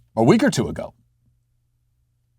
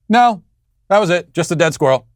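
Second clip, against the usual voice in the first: put-on higher-pitched kid's answer; imitated child; 6 semitones higher, 3 dB louder than usual. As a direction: lightly mimic a child reporting back. Father voices child's answer.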